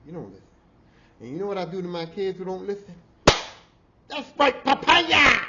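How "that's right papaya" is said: high, loud triumphant yell; loud punchline shout. Acted. To the audience, a high, loud triumphant yell. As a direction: bellow the punchline. Punchline about hitting the kid.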